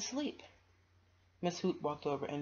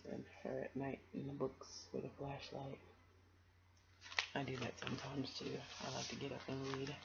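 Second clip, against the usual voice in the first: soft personal aside; quiet aside; 2 semitones lower, 8 dB quieter than usual. Real, not acted. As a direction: drop your voice to share.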